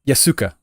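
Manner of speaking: "Yasuka" ending in an ah; mispronounced Yasuke. Retold or imitated. Streamer quoting someone's annoying mistake, mispronounced "Yasuka". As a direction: say "Yasuka" with a final kah. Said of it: "it annoyed me that he, he says".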